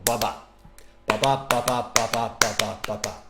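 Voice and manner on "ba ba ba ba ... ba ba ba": "ba ba" in pairs, weak then strong; iambic rhythm. Shown as a demonstration. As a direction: beat out "ba BA" in even iambs. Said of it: "an unstressed syllable followed by a stressed".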